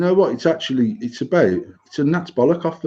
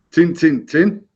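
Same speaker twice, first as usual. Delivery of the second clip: broad Yorkshire TIN, TIN, TIN; three punchy Yorkshire tins; stronger Yorkshire accent; 3 semitones higher, slower than usual. Shown as a demonstration. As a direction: lay the Yorkshire on thicker, lifting the last tin. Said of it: "do it in a more Yorkshire accent".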